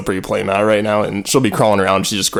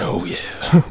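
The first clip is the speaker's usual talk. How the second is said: sultry whisper. Pretend. Continuing sultry voice.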